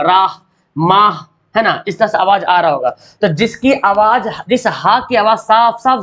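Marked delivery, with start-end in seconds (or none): 0.0-1.2 राह, माह slow, ending on audible "h"; clearly sounded "h". Shown as a demonstration. Teacher giving examples, राह, माह slow with final "h" sounded. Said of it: "आवाज़ हा आ रहा होगा".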